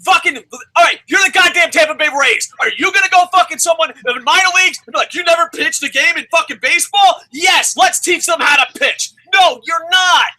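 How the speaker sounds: heated shouting. Real, not acted. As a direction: shout down your opponent. Shouting down the co-host in argument.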